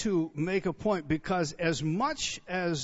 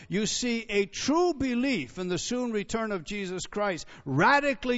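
louder, driving repetition of radically; preaching; 2 semitones higher, 5 dB louder than usual. Acted. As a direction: preach it harder with each line. Builds a preaching crescendo.

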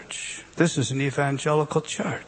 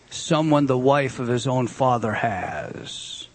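slow, stressing "Someone" first; emphatic word order; 2 semitones lower, slower than usual. Shown as a demonstration. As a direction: spell out the odd order slowly. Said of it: "Whenever Greek mixes up the words, it's for emphasis".

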